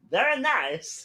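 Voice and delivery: put-on high-pitched comic foreign-accented voice; hammed-up high comic catchphrase voice; catchphrase impression. Joking with friends, in a high put-on comic accent. Quoting a film character's catchphrase.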